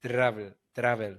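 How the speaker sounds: travel with a trilled R at the start; imitated rolled R. Comic practising the host's travel, rolled R. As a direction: roll into travel straight off the T. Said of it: "it starts at the beginning".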